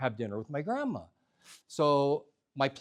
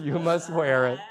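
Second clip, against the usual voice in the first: louder, laughing repeat of the plea; laughing insistence; 7 dB louder than usual. Retold or imitated. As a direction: laugh it out as you insist again.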